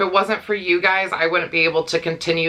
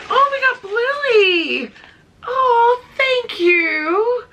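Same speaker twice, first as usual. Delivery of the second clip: very high, slow squeal, half crying; overjoyed squeal; 4 dB louder, much slower than usual. Real, not acted. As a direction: squeal with tearful joy over the gift. Opening gift parcel, overcome.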